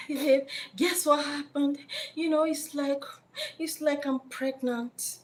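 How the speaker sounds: mock-sweet phone announcement; coy girlfriend. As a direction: tease out the big news, sweetly. Woman calls boyfriend with news.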